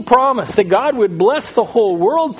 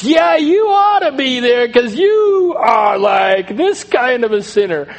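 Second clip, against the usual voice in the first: mock accusing; 3 semitones higher, 4 dB louder, slower than usual. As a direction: imitate a buddy loudly pointing out the other's sin. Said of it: "watching sinners accuse each other".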